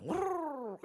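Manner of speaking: high trilled vrrr; imitated engine roar. Bus speeds off with the preacher, imitated engine noise.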